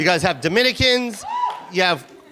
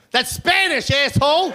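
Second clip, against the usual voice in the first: cop yelling; 5 semitones higher than usual. Retold or imitated. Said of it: "this is what I hear".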